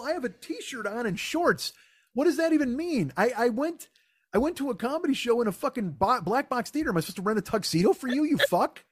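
high-pitched exasperated disbelief; comic outrage, aggrieved and high; indignant rant. Retold or imitated. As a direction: protest to a friend, voice way up. Acted rant about dress remark.

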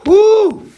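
loud falsetto whoo; high whooping cheer. Real, not acted. Host letting off steam, loud high whoop. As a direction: whoop like celebrating.

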